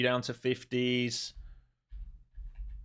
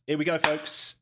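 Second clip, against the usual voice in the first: excited; 8 semitones higher, 4 dB louder than usual. Real, not acted. Move starting, hyping viewers.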